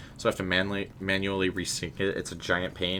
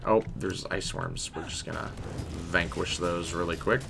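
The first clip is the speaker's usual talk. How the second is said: normal talking voice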